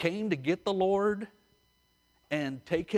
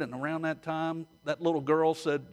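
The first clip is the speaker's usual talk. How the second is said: usual speaking voice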